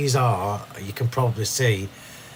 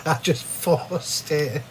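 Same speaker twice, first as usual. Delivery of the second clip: laughing; 4 semitones higher, 4 dB quieter, slower than usual. Real, not acted. Laughing at own craft mishap.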